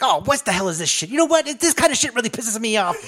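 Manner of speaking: high-pitched imitation of a fed-up old man; bitter, frustrated. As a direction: gripe like a thwarted villain, pitch jumping up. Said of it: "just fucking bitter".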